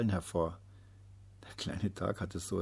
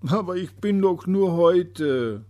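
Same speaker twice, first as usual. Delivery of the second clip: high, loud, slow desperate plea; desperate cry; 9 semitones higher, 10 dB louder, slower than usual. A narrator's reading. Despairing little day crying out to the sun. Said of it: "rief er".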